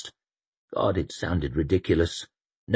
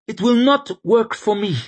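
high, raised, firm refusal; defiant young priest; 6 dB louder than usual. Acted. Sufin refusing the Inquisitor, high and defiant. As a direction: stress not, high and loud.

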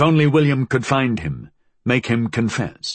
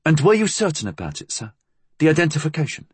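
deferential inspector; 7 semitones higher, faster than usual. A narrator's reading. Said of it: "Blackwell cleared his throat".

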